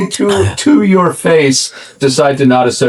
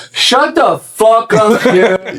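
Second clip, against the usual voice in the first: retold heckle; 7 semitones higher, much slower than usual. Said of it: "you just hear".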